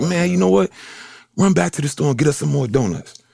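a lightly voiced teammate giving orders; bossy vets. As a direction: order the rookie around, half joking.

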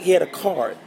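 ordinary talk

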